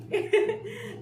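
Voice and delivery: loud high burst of laughter. Real, not acted. Laughing hard at her son's mix-up, a loud high laugh.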